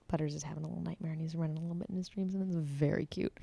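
soft fast whisper aside. Real, not acted. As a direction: whisper so the dog stays asleep. Whispers aside about sleeping dog.

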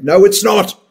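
emphatic no. Real, not acted. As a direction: shut the excuse down hard. Firm rebuttal to vaping excuse.

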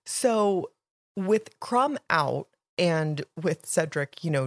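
normal talking voice

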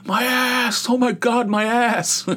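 high, frantic mock yell of someone desperate for the toilet; panicked bathroom rush. Mocking a desperate bathroom dash, high panicked yell. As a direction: squeal it like you're about to lose control. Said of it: "I'm not like".

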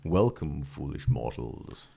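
very low, slow ghostly menace; slow theatrical villain menace. Acted. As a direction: greet them like a haunted-house host. Spooky ghostly greeting with cup.

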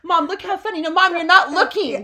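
high, loud mock-kid insistence; attention-seeking child. Child showing mom something funny, high insistent pleading. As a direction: insist like a kid being ignored, amused.

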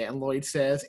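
usual speaking voice

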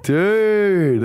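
high, drawn-out greeting to a mate; acted amazed greeting. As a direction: stretch it out in delighted recognition. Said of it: "And I'm like".

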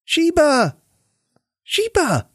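high yell of the cat's name; small boy yelling for his cat. A narrator's reading. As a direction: shout for the cat, boy's alarm. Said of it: "Denny yelled at almost the same time".

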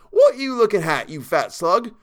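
loud, high Cockney challenge; retold Cockney yell of abuse; angry challenge. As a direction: pick a fight in Cockney, pitched well up. Servant acts out his own challenge.

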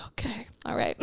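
uncle's whispered okay, hushed and breathy; half-hearted whispered agreement; mock whisper. Uncle quoted while eating chips.

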